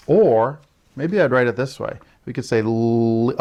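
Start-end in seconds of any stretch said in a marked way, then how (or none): 2.6-3.4 a long held "l" alone; stretched L sound. Shown as a demonstration. Teacher isolating the first sound for children, long stretched "l". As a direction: hold the "l" that starts "leash". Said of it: "I hear that L sound at the beginning".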